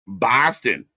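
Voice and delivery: put-on a quick, light Boston accent on the word Boston. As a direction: drop the r in Boston like a local. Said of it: "That's my Boston accent".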